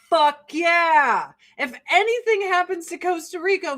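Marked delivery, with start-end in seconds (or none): none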